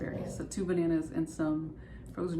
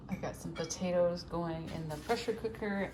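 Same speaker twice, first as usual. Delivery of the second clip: ordinary talk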